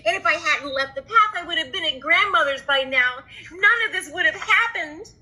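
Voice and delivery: put-on high, fast regretful child; panicked little girl. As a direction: berate herself breathlessly.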